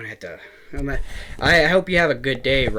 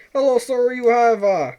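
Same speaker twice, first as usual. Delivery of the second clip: much higher mock Indian caller accent; Indian scam caller accent; 11 semitones higher, 3 dB louder than usual. Retelling a scam call, mocking the Indian caller in a higher accent. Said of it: "like, an Indian guy".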